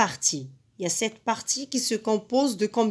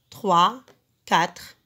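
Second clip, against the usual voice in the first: ordinary delivery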